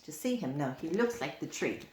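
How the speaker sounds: plain reading voice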